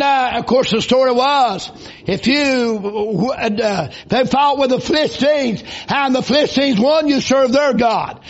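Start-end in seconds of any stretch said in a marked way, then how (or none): none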